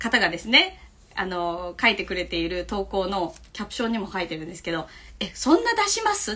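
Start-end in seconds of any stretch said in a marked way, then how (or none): 5.2-6.3 mock surprise, pitched high; imagined surprised reaction. Staff member reacting to the teacher's many gifts, mock surprised. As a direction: voice a colleague's startled question. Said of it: "っていう".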